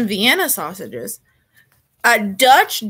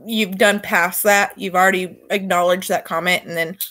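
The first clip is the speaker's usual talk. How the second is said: ordinary talk, nothing marked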